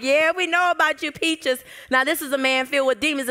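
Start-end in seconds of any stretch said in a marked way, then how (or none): 0.0-1.6 high, loud taunting sneer; mocking demon voice. Demon-filled man taunting Mary Magdalene, high mocking voice. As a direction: jeer at her like the demons do. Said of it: "He's like".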